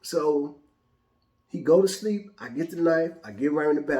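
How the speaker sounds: normal talking voice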